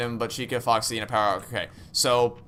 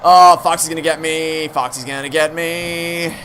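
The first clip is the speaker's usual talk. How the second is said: panicked cry; 5 semitones higher, 9 dB louder than usual. Real, not acted. Panicked at Foxy.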